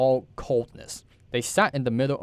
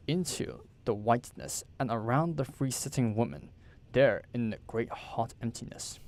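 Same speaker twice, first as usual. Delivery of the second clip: usual speaking voice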